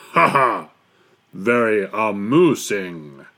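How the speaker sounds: loud, slow, sarcastic bear laugh and retort; Bear's mocking roar. A narrator's reading. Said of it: "roared Bear".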